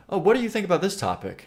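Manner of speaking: high, quick mock interviewer question; lightly acted street-interview opener; reel interviewer. Imagined helper asking the streamer about a topic, mock interviewer voice. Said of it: "get somebody to, you know, give me the phone".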